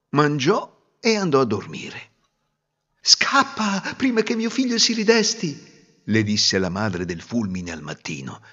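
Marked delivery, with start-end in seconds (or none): 3.0-5.7 lightly voiced, higher hurried warning; urgent old woman. Old mother of the wind urging the girl to flee. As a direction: hurry her off kindly.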